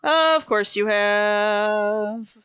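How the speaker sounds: very slow, stretched-out reply; drawn-out resignation. Real, not acted. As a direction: drag the words out as if unsurprised. Dry reaction to friend's find.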